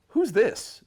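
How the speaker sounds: high, loud question; imitating Jeff. Jeff noticing a stranger in the room, high and loud. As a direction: ask sharply who's there. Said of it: "Jeff goes".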